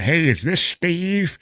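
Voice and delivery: high, slow hammed-up thug voice; mock inmate phone call. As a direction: ham up a dumb inmate calling on a recorded line. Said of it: "I'm like".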